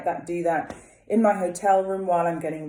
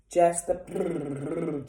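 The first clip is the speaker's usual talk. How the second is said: gentle lip trill; much slower than usual. Shown as a demonstration. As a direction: let the lips buzz gently. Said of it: "no forcing".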